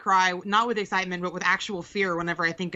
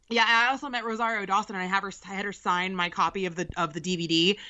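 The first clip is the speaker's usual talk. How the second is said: ordinary talk, nothing marked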